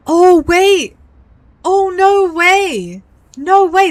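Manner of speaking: loud, high cries of disbelief; real astonishment, belting it out high; stunned realisation. Real, not acted. Streamer realising they could be related, stunned disbelief. Reacts to plot twist.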